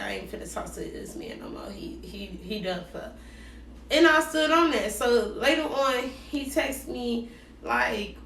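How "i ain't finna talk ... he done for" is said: breathy whispered vow to drop him; whispered resolve. Retold or imitated. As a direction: whisper it like a private decision. Retelling her inner resolve to quit.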